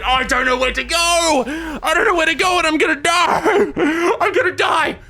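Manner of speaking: lost in the dark, shouting in panic; panicked shouting. Real, not acted.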